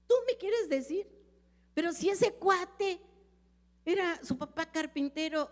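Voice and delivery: high, mocking disbelief; mock the proud doubter's sneer; scoffing skeptic. Preacher imitating a scoffer dismissing the gospel, high and sneering. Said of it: "Así algunos te dirán".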